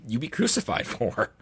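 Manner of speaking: ordinary talk, nothing marked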